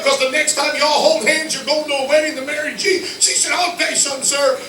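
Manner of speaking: high, loud building sermon cry; rising preacher's fervor; preaching at full force. Acted. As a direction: drive it home to the congregation.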